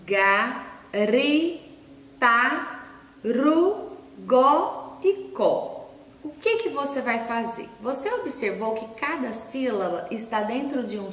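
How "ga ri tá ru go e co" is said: each syllable separate and slow; slow syllable-by-syllable reading; reading syllables aloud. Shown as a demonstration. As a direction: sound out every syllable for kids. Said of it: "onde está escrito".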